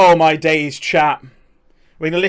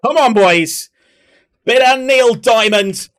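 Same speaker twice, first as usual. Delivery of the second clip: loud, higher, stretched-out words; rousing call; 6 semitones higher, 6 dB louder, slower than usual. Real, not acted. Streamer getting the boys singing [name], rousing call. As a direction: whoop up the crowd. Rallying viewers to the song.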